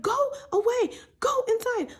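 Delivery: very high, loud mock yell; imitated angry order. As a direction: mimic her shooing someone off. Retold Katie ordering Raquel away.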